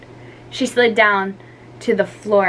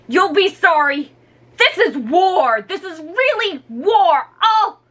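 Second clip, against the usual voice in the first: higher, louder furious threats; angry yelling; 10 semitones higher, 3 dB louder than usual. Acted. Ricky to his sisters, furious yelling. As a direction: declare war at the top of your voice.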